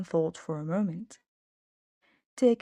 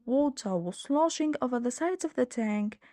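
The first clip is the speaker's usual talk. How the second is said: ordinary delivery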